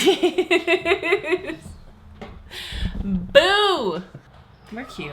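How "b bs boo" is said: high laughing ghost voice; spooky ghost boo. Pretend.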